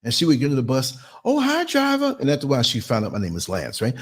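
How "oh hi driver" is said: high, sing-song church-lady hello; sweet high greeting. Retold or imitated. Bus rider greeting the driver, high sing-song hello.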